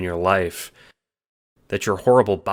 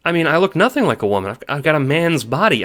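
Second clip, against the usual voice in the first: higher, louder, fast anxious blurt; fast flustered pleading; anxious rush; 8 semitones higher, 5 dB louder than usual. A narrator's reading. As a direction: rush it out nervously. John blurting out worries.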